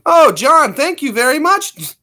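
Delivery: high, loud gushing thank-you; excited thanks. Real, not acted. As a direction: gush over the donation. Thanking a super chat donor.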